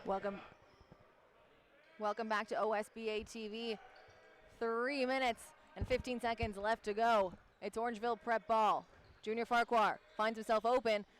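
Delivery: brisk courtside call; live play-by-play. Acted. Play-by-play announcer calling the game for viewers. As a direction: call the action as it happens.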